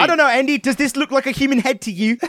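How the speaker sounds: badly imitated New Zealand accent, on the edge of laughter. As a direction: quote her in a hammed-up Kiwi accent. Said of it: "soft New Zealand accent".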